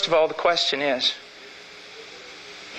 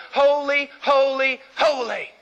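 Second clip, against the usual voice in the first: ringing proclamation; 6 semitones higher, slower than usual. Shown as a demonstration. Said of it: "Hebrew parallelism".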